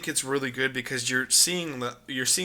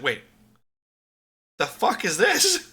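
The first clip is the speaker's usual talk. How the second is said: alarmed surprise; 6 semitones higher than usual. Retold or imitated. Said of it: "you're like".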